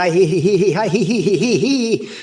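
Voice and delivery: rising he he he giggle; giggling. Acted. Giggling after trailing off.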